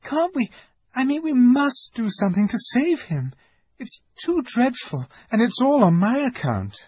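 put-on very high, upset girl's voice; distressed pleading. A narrator's reading. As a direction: beg for help, stricken with guilt. Lucy pleads to save Tumnus.

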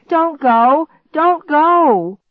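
high, loud plea, building; desperate shout. A narrator's reading. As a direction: beg them at full voice to stop. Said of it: "I shouted as I reached".

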